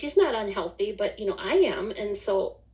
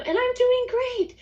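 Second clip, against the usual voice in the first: much higher, bright rising joy; excited; 4 dB louder than usual. Retold or imitated. Retelling her inner delight at the text, much higher.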